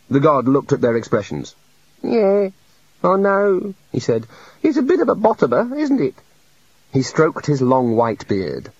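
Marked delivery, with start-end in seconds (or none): 2.0-6.2 much higher, a small mopey god voice; high meek little god. A narrator's reading. The little god confesses to the wizards, meek and high. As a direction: play a shy, sorry deity.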